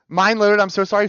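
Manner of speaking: ordinary delivery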